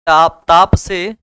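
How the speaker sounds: normal talking voice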